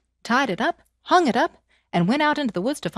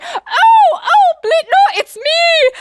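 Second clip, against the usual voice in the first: much higher, loud, frantic pleading; desperate cry; 9 dB louder, much slower than usual. Acted. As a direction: wail to stop the beating. Wife cries from the sack.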